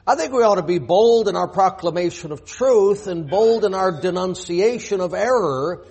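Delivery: ordinary talk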